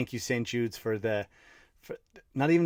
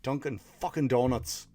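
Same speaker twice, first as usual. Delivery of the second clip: ordinary delivery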